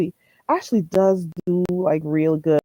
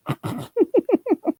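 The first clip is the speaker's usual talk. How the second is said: short high laugh; 13 semitones higher than usual. Real, not acted. Laughing with chat.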